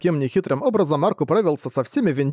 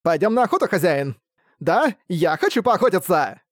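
high, gleeful, hungry vampire; sly teasing trickster spirit; 4 semitones higher than usual. Acted. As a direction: egg him on, eager for blood. Вампир зовёт хозяина охотиться. (хитрый игривый вампир)

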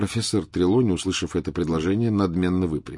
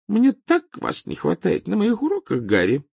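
languid; 13 semitones higher, 4 dB louder than usual. A narrator's reading. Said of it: "томно произнесла профессор".

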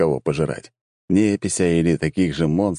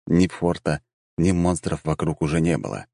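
ordinary delivery, nothing marked

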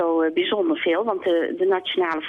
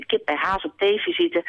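ordinary talk, nothing marked

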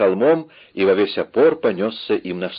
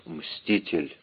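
much softer, lower dying word; whispered; 4 semitones lower, 9 dB quieter than usual. A narrator's reading. Dying Winter names his killer, whispered. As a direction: let it out hushed and low. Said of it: "прошептав".

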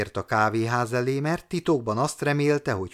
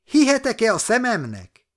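high, loud amazed cry; can't believe his eyes; delighted astonishment; joyful disbelief; 10 semitones higher, 5 dB louder than usual. A narrator's reading.

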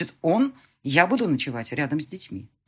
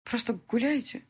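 confused; 7 semitones higher than usual. Acted. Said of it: "спросила я растерянно".

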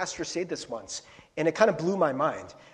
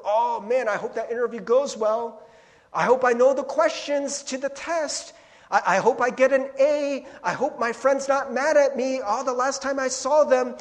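mock worrying; 7 semitones higher, 8 dB louder than usual. Anxious inner voice about future.

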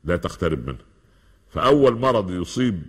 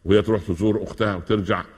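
ordinary delivery

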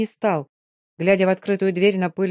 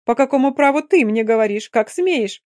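mimicking haughty girl; 5 semitones higher, 5 dB louder than usual. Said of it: "а она".

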